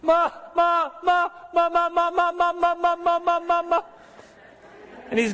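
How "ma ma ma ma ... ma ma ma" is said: put-on high, calling little-boy voice; toddler calling mom. Preacher imitating his little son calling for mama, high child voice. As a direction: cry out like a needy toddler.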